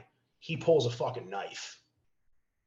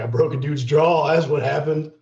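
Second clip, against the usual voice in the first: proud boast; 2 semitones higher, 9 dB louder than usual. Retold or imitated. Telling friends proudly.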